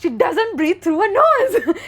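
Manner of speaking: loud, high imitation of an outraged friend; indignant retold outburst. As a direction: protest loudly, breaking into laughter. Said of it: "Rishit stares at Nushki and he just goes".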